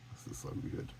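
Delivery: breathless little whisper of delight; a real hushed aside. Real, not acted. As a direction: whisper it to yourself, thrilled. Delighted aside while reading card.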